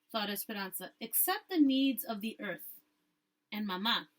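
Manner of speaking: ordinary delivery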